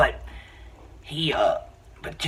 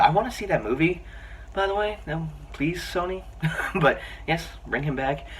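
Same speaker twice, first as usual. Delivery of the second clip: excited; 8 semitones higher, 4 dB quieter than usual. Real, not acted. Begging Sony for a sequel.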